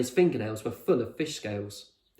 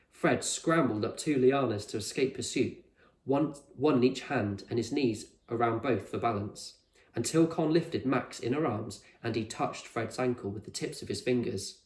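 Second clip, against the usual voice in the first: ordinary delivery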